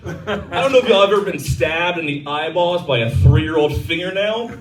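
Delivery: ordinary talk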